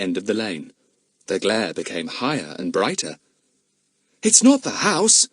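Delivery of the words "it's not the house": put-on high excited boy's cry; cried out. A narrator's reading. Boy crying out at the fire, excited. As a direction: cry it out to the others. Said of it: "cried Larry".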